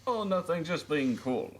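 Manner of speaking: lightly put-on Russian accent; hint of a comic Russian voice. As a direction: play Kenny as a mock Russian. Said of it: "why is he Russian?".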